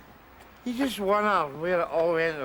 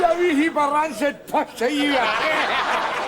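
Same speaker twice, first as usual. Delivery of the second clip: loud, higher-pitched abuse; pitched-up, full-voiced tirade; shouted insult; 6 semitones higher, 7 dB louder than usual. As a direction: hurl it out loudly, relishing the insult. Hurling insults in acted scene.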